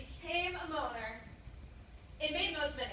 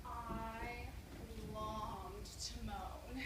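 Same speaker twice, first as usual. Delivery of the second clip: slow, soft and low confession; hushed longing; 5 semitones lower, 10 dB quieter, much slower than usual. Acted. Performer confesses she longed to moan, hushed and yearning. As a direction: draw it out quietly, full of longing.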